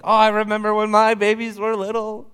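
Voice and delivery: high, loud, tender cooing. Acted. Papa remembering his own babies, high tender cooing. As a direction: coo over the baby. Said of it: "he cooed".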